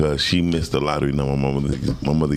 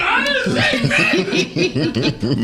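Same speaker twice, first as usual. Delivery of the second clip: much higher, slow laughing whine; laughing plea; 3 dB louder, much slower than usual. Retold or imitated. The son on the ground pleading to his mama, laughing high. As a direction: whimper the denial through laughter. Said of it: "talking to my mama like".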